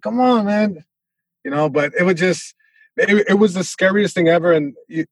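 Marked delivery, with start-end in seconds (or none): 0.0-0.8 lightly mimicked challenge, pitched higher; a hint of dad daring someone on; impression of dad. Son showing dad's chest-pat challenge, light impression. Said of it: "It was always like".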